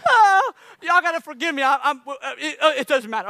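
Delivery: can't stop laughing while apologizing; laughing fit. Real, not acted. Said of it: "he's crying under here".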